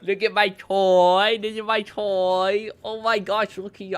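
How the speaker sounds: slow, drawn-out gushing over a toy; mock toy lover. Mocking collectors with a borrowed toy, mock toy lover. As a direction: stretch the words in mock delight. Mocks a toy owner showing off.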